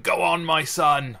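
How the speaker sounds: loud, high, drawn-out cheer; rousing cheer. Acted. Imagining the last sonnet's punchline, rousing loud cheer. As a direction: bellow it slowly like a proud bloke. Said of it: "a couple of words maybe going".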